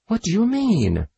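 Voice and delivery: sudden high frightened cry; a startled maid's frightened question; terrified. A narrator's reading. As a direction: gasp it out in terror. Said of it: "abject in her terror".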